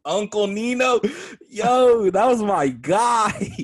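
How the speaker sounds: loud, slow, delighted cheer; excited. Real, not acted. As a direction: cheer for your favourite guy. Excited about Uncle Nino.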